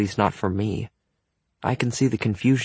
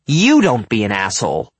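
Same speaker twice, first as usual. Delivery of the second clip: louder, hard stress on "You"; angry retort; 6 dB louder than usual. A narrator's reading. Jared retorting before he storms off, angry. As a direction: throw it back, punching "You". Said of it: "he says and storms off".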